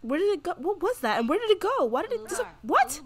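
high-pitched, startled confusion; bewildered outburst. Real, not acted. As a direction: fire off baffled questions, voice shooting up. Medal suddenly vanishes in game.

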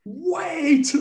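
high, stretched-out mock roar; read-aloud roar. A narrator's reading. Narrator roaring after the departing butler, theatrical roar. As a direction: call it out high and long, hammed-up. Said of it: "I roared".

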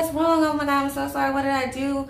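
mock a flustered man apologizing; alarmed apology. Man reacting to her tears, retold alarmed apology.

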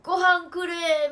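loud, high, drawn-out mock plea; begging for food. Streamer voicing the pet waiting by its food, loud mock begging. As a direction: voice a pet pleading for dinner. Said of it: "みたいな感じで這いつくばっている".

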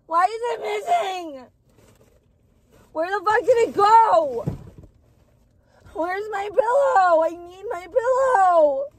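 high, loud, sobbing complaint; crying wail. Real, not acted. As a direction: bawl each plea at the top of your voice. Crying meltdown over lost pillow.

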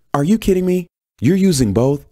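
high, loud disbelief; indignant protest. A narrator's reading. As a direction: protest as if you can't believe it. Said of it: "Janice protested".

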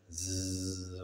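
imitated zzz of someone asleep; drawn-out sleeping buzz. A narrator's reading. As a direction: buzz one long, even zzz. Sleeping Lulu's snore imitated.

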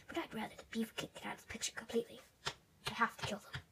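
fast secretive whisper; scheming whisper. Pretend. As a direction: hiss the plan to yourself. Villain plotting alone.

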